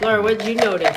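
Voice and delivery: loud call across the room. Real, not acted. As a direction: call her name to get her attention. Parent calling on a child.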